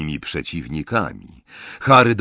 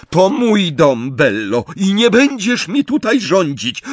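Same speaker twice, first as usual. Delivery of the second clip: high, loud, defiant objection; indignant retort; 10 semitones higher, 5 dB louder than usual. A narrator's reading. Narcyza tells sister who rules her house, indignant. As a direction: stand your ground in a raised voice.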